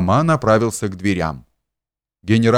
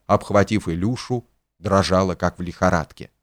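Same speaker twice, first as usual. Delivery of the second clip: usual speaking voice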